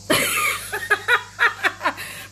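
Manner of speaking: high, long cackling laugh; burst of laughter. Real, not acted.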